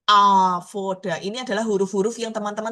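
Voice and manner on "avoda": "Avoda" said slowly and louder, falling; slow model word. Shown as a demonstration. Teacher repeats the Hebrew word for students, slow and firm. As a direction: give "Avoda" slowly and firmly. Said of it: "Misalkan lagi".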